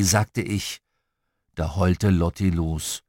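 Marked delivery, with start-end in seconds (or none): none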